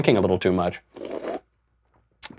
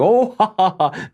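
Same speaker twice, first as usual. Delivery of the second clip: mock crowd laughter; 11 semitones higher than usual. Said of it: "the whole crowd is laughing".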